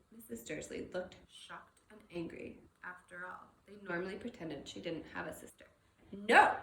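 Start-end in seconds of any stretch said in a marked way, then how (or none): 6.1-6.6 a much louder, cutting no; sharp no. Acted. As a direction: snap it shut. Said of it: "she said sharply".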